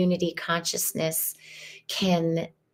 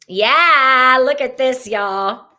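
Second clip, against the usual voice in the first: much louder and higher, thrilled at the card; loud, high, real excitement; excited; 6 semitones higher, 8 dB louder, slower than usual. Real, not acted. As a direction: burst out with delight at what you see. Excited at the drawn tarot card.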